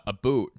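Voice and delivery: "a boot", with an oo vowel, high and loud; Canadian "about". Shown as a demonstration. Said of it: "we could throw in Canadian".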